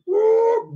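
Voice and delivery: high quick whoop; a burst of praise in the reading; short soaring woo; joyful woo. Real, not acted. Preacher whoops mid-reading.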